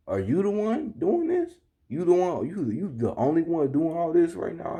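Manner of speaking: high-pitched incredulous question; imitated female coworker. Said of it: "it was a female".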